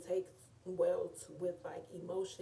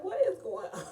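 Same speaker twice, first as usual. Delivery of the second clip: high, louder incredulous squeal breaking into a laugh; baffled reaction; 10 semitones higher, 4 dB louder than usual. Retold or imitated. Watching Lena shake the charm, high incredulous laughing squeal. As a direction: shriek it in disbelief, then laugh. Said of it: "I was like".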